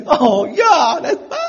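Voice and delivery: loud high wail of "难办"; hammed-up loud high lament; mock men lamenting. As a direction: wail it like a helpless man. Men groaning together.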